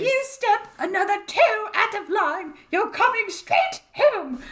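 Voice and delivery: high, shrieking motherly scold; shrieky scolding voice. Pretend. Sister doing a high shrieky scold for fun, mock fury. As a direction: screech the warning like an angry mum. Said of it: "what that accent is I'm doing".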